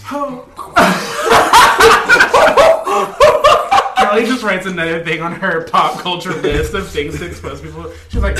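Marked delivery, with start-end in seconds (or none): 0.5-3.9 long loud rising laugh; big delighted laugh. Real, not acted. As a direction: burst out laughing.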